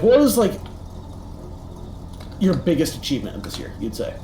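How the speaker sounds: normal talking voice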